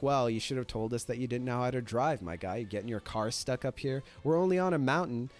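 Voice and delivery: ordinary delivery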